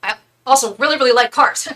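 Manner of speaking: lightly voiced, rambling mock talk-show host; mocking Leno as unfunny, laughing through it; Jay Leno impression. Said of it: "this is my impression of him".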